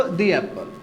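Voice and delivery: "दी एप्पल" with "दी" as dee; long ee leading into "एप्पल"; "दी" before a vowel. Shown as a demonstration. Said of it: "दी पढ़ेंगे इसको". ("दी एप्पल" में लंबी ई)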